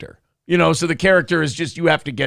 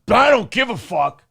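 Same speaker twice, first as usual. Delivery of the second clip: mock dismissive; 3 semitones higher than usual. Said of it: "ruin it by going".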